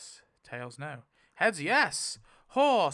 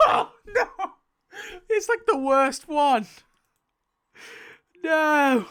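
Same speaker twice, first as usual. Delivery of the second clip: much higher, loud laughing scream of despair; real horrified shout at the carnage; dismayed shout; 11 semitones higher, 10 dB louder, slower than usual. Real, not acted. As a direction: yell it in disbelief. Six deaths in the finale.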